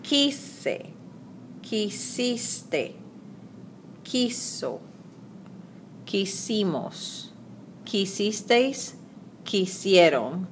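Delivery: each form said slowly and softly on its own, with a pause before the next; careful pronunciation demo. Shown as a demonstration. Teacher modelling the verb forms for students, careful and clear. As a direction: spell out "Quise", "quisiste", "quiso" syllable by syllable for students to copy. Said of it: "so take a listen".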